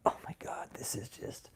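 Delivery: whispered disbelief under the breath; hushed aside of disbelief; exasperated whisper. Real, not acted. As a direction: breathe it out in dismay.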